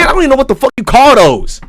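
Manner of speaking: ordinary delivery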